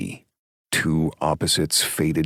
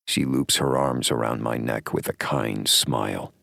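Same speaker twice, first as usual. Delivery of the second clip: usual speaking voice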